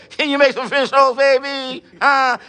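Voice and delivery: a hint of Bernie Mac teasing a woman; Bernie Mac style. Preacher teasing sisters in a light Bernie Mac voice. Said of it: "in my Bernie Mac way".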